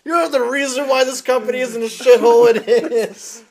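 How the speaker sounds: loud, high heel promo rant; wrestling promo. Acted. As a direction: yell it like a heel calling out the boss. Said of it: "cut a promo on Hogan".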